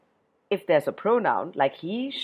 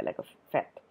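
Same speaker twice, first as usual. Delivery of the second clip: usual speaking voice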